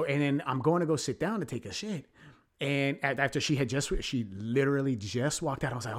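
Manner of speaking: normal talking voice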